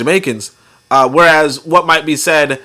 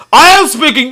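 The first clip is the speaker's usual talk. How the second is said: high, loud hammed-up English accent; mock English accent; 10 semitones higher, 5 dB louder than usual. Imitating a classmate answering back, mock English accent. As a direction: declare it indignantly in a fake English accent. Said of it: "the other one was like".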